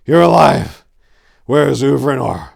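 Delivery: loud relieved outburst; relieved shout to a comrade. A narrator's reading. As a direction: greet the survivor with relief. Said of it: "Tor said with relief".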